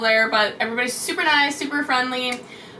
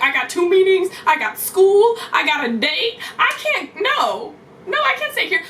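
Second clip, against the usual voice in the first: higher and louder, frantic; high frantic protest; panicked; 5 semitones higher, 3 dB louder than usual. Retold or imitated. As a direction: rattle it off in a panic. Panicked list of obligations.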